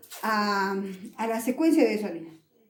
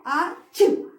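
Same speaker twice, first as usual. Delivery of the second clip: mock sneeze; 3 semitones higher, 4 dB louder than usual. Shown as a demonstration. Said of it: "¿qué hago?".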